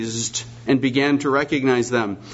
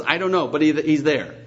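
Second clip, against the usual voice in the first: normal talking voice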